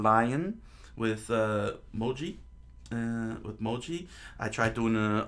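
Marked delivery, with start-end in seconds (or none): none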